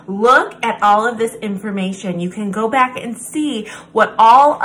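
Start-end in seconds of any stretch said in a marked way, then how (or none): none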